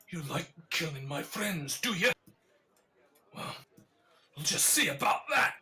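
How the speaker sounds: rising to a loud threatening shout; angry threat. Acted. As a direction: threaten her, building to a shout. Thug threatens Yagra.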